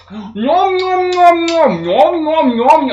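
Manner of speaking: high, loud cartoon nom-noms after a gasp; exaggerated squeaky eating sounds; mock munching noise. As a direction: ham up the disgusting chewing. Imitating her gross eating noises.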